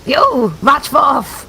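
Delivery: slow, drawn-out high shout; calling out. Acted. Character hollers to the group as they set off, drawn-out shout.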